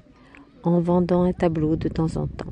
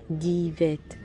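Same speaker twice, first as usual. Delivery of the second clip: ordinary delivery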